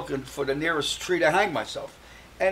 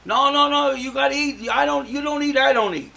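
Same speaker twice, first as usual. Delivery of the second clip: much higher and louder coaxing; insistent cellmate; 10 semitones higher, 8 dB louder than usual. Retold or imitated. Cellmate Maury pushing depressed Mike to eat, high insistent voice. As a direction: nag him to eat, loud and high.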